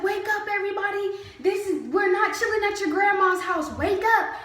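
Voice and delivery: mock the officer shouting everyone awake; imitating a yell. Officer yelling at the sleepy recruits, imitated shout. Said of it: "she's yelling at us".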